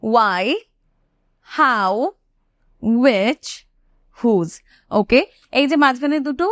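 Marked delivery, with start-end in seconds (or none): 0.0-4.5 each wh word said alone, slowly, with pauses; pronunciation demo. Shown as a demonstration. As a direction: model why, how, which, whose one at a time for learners. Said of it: "আরেকবার বলে দিচ্ছি".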